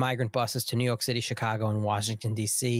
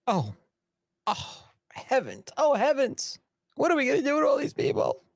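high-pitched pearl-clutching panic; hammed-up flustered dismay, pitched way up; mock panic; 11 semitones higher than usual. Imagining islanders facing the arrivals, high mock panic. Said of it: "can you imagine the panic".

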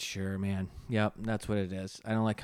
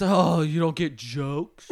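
slow, drawn-out mock taunt; put-on "you don't get it" voice; 3 semitones higher, 5 dB louder, slower than usual. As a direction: imitate a jeering know-it-all, louder. Mocking those who say it.

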